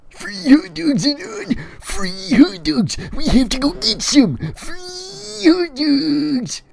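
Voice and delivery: loud, high-pitched squealing with drawn-out words; hammed-up shrieking excitement; excited yelling. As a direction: screech it like wildly overexcited news. Eric's excited outburst, acted.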